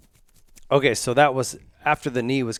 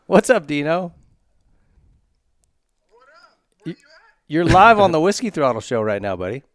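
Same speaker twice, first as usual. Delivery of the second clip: loud, higher-pitched hail to a caller; calling out; 6 semitones higher, 8 dB louder than usual. Real, not acted. Host greets a friend on speakerphone, calling out. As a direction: pitch it up and project to the phone.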